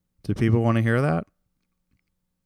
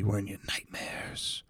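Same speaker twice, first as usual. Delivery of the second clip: creepy whisper; 10 dB quieter than usual. Pretend. Podcaster creepily whispering to listeners.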